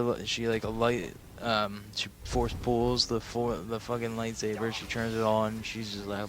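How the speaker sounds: usual speaking voice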